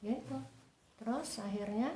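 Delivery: ordinary talk, nothing marked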